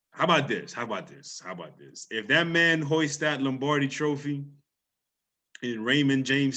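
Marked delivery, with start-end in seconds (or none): 0.1-2.1 teasing. Real, not acted. Teasing repeated lead-in.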